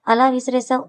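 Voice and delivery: ordinary delivery, nothing marked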